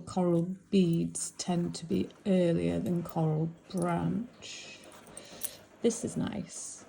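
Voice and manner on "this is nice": soft slow whisper. Real, not acted. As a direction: breathe it out admiringly. Admiring the piece quietly.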